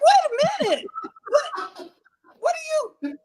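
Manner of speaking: very high, startled confusion; exaggerated high-pitched protest; mock bewilderment. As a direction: squeal it, then sputter in disbelief. Acts confusion at sleep talk.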